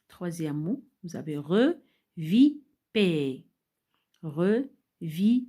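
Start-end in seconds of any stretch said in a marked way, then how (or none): 1.4-5.4 "reviper" loud, split as re, vi, per; syllable by syllable. Shown as a demonstration. Teacher showing syllables to order, "reviper" said in three pieces. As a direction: break "reviper" into three slow separate syllables. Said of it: "Vous mettez les trois syllabes".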